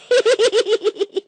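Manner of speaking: high cartoon cackle; greedy high cackle; gleeful cackle. Wants lots of fish.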